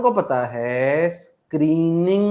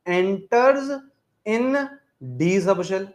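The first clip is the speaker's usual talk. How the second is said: very slow, drawn out, a touch higher; slow emphasis; 3 semitones higher, much slower than usual. Shown as a demonstration. Teacher stressing the key phrase for students, very slow. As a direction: stretch the phrase for note-taking. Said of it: "slow emphatic key phrase".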